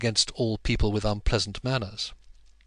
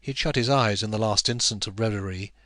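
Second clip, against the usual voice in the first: ordinary delivery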